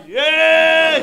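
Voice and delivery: high, loud mock cheer like scoring a goal; mock triumphant yes. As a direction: celebrate a glass on the table like a goal. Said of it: "מלצר, שם כוס על שולחן". (קריאת ניצחון מתלהבת בצחוק)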